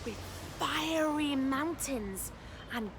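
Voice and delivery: put-on picture-book reading for children; lively storytime narration; story narration. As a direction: read it aloud with wonder for little ones. Reads picture book aloud.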